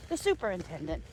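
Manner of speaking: ordinary talk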